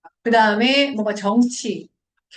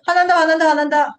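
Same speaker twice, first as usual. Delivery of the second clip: high, loud repeated outburst; hammed-up flare of temper; mock anger; 6 semitones higher, 5 dB louder, faster than usual. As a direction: play at getting worked up. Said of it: "편도체야".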